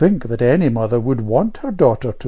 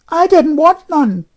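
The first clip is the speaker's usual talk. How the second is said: put-on high, loud defiant girl; defiant child; 9 dB louder than usual. A narrator's reading. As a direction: fling it back defiantly. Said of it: "said Jane defiantly".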